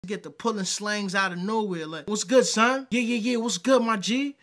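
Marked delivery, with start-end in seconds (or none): 2.1-4.4 mock New York slang, raised and louder; pushy, hammed-up slang greeting; put-on New Yorker. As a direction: imitate someone overdoing New York greetings. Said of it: "they try to put on so hard".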